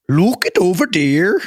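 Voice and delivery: slow, clumsy stab at a Norwegian accent; attempted Norwegian accent. Shown as a demonstration. Friend attempting the villager's line, rough Norwegian accent. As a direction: try a Norwegian accent and stretch it out. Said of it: "can't even remotely get close to that".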